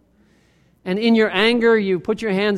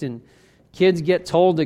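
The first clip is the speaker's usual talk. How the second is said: ordinary delivery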